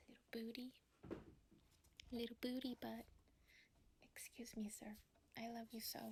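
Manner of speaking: hushed, tender pet talk; cooing to cat. Real, not acted. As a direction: coo softly to the cat as it interrupts. Whispering sweetly to her cat.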